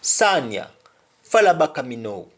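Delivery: "Sanya, fala baka me know" fast, in a Jamaican Patois lilt; a quick Patois call to follow. Shown as a demonstration. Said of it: "the example is".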